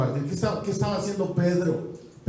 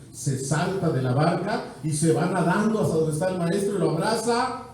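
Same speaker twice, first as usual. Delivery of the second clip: usual speaking voice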